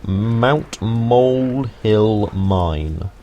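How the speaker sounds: "Mount Molehill Mine" slowly drawn out, word by word; laboured, careful pronunciation. Real, not acted. Guide struggling with a tricky name, "Mount Molehill Mine" said slowly and carefully. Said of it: "I found that really difficult to say".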